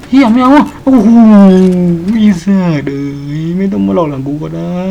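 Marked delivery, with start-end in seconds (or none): 0.1-2.9 much higher, loud frightened shriek; terrified shriek. Real, not acted. Streamer spooked by a ghost, shrieking in panic. Scared by ghost behind him.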